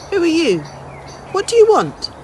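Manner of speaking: put-on an old woman calling out sharply at an intruder; sharp old lady challenge. Old lady confronts stranger in rain.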